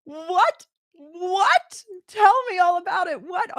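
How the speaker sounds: high, loud astonished cries; mother hearing of the scholarship, high and thrilled; excited disbelief. Retold or imitated. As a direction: shriek it in happy shock. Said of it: "I was like".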